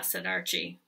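usual speaking voice

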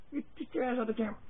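high-pitched fast scold at the dog; fast high-pitched shoo. Real, not acted.

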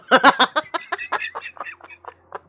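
a sudden high airy laugh; high breathy laugh. Real, not acted. Player laughs after a cheeky joke to viewers.

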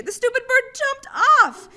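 high, loud exasperated cry; frustrated outburst. A narrator's reading. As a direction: blurt out your annoyance. J J frustrated at the bird.

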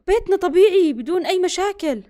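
ordinary delivery

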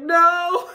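high, loud miserable "No"; loud whiny sick "No"; mock pained wail. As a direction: wail it out, hammed-up. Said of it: "I'm like".

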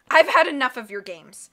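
loud furious roar. A narrator's reading. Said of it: "Count Olaf roared".

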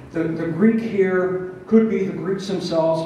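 ordinary talk, nothing marked

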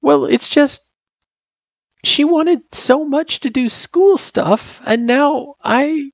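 put-on very high, halting kid voice; hesitant worried child. A narrator's reading. Girl confessing worry about letting mom down, hesitant. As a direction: falter slowly in a high child voice.

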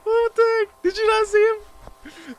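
real high-pitched, loud panic; shocked outburst. Real, not acted. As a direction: squeal it out in startled disbelief. Startled by figure in video.

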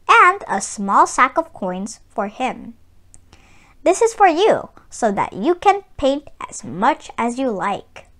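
calm storybook reading, evenly paced; story narration. A narrator's reading. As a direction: read it like a picture book to children. Reads the story aloud.

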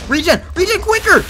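high, loud desperate calls; real panic, yelling for help and mom; panicked screaming for help. Real, not acted. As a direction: scream for rescue as you die. Dying, calling friend for help.

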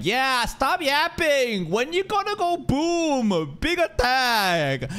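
drawn-out, whiny demand for the big attack; mock an impatient viewer who wants explosions; impatient mock heckle. Viewer mocked by streamer, drawn-out impatient whine. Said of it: "Most people are like".

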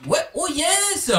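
triomphe aigu et étiré; joie triomphante. Real, not acted. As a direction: savoure la victoire. Joie après le combat. (cri de joie aigu)

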